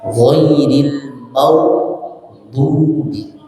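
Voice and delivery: "Ghairil maghdubi" very drawn out, lips rounded forward; lips pushed forward. Shown as a demonstration. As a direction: hold each syllable of "Ghairil maghdubi". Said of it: "tapi mono ke depan".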